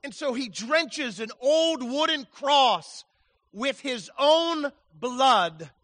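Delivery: loud, slow, held phrases; fervent preaching. Acted. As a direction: drive the image home. Preaching at full force.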